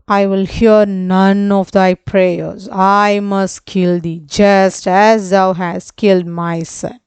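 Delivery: usual speaking voice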